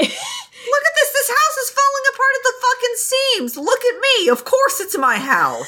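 high, laughing mock rant; hammed-up cranky homeowner laughing; laughing grumpy villager. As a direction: rant through laughter in a squeaky old-man voice. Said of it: "this guy's like".

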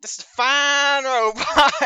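overplayed in-character salesmanship; hammy admiration. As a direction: stretch it out with a chuckle. Hams up the praise in character.

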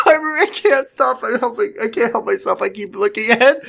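laughing while reading; can't stop giggling. Real, not acted.

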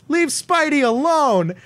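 high, drawn-out call; mock heckling yell. New Yorker defending Spider-Man from Green Goblin, high mock yell. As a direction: call it out slowly across a street.

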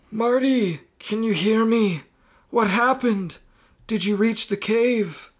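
high, wrinkly elder voice; old person voice. Acted. Mysterious voice checking on Marty in his head, old person voice. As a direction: question him as an aged, otherworldly voice. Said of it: "not think of a wrinkly Zachary Quino".

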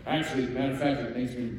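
usual speaking voice

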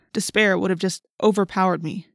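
plain reading voice

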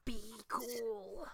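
echoing a tight-jawed order; clenched teeth. Retold or imitated. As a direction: squeeze it through shut teeth, quietly. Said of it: "clenched teeth all the way".

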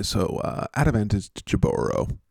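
ordinary delivery

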